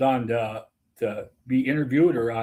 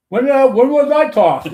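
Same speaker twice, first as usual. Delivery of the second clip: loud, much higher, slow incredulous question; baffled protest; 12 semitones higher, 9 dB louder than usual. Retold or imitated. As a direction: play the stunned ballplayer who can't believe it. Ejected player asks, baffled.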